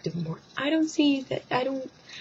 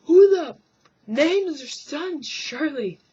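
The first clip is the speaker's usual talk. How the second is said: high loud outburst, then slow; exasperated shout; 7 semitones higher, 5 dB louder, slower than usual. Real, not acted. Vlogger ranting about naming a son Shirley, loud. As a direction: blow up in disbelief. Outraged rant.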